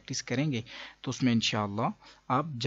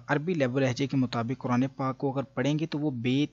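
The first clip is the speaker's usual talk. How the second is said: ordinary delivery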